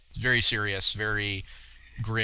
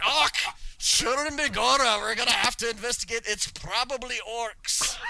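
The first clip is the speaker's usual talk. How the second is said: hammed-up Scottish accent, much higher and louder; a deliberately terrible Scottish brogue, pitched high; bad Scottish accent; 11 semitones higher, 4 dB louder than usual. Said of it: "doing our worst Scottish accent".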